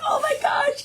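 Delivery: very high, thrilled mock reply; high-pitched acted excitement; excited squeal. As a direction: imitate friends squealing in recognition. Friends' retold excited reply.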